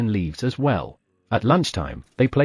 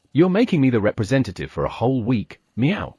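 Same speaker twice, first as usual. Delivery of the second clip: plain reading voice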